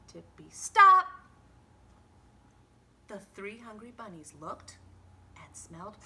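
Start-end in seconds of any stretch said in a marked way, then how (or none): 0.1-1.1 high, loud halt; sudden stop. A narrator's reading. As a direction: slam on the brakes. Bunnies halt at the wall.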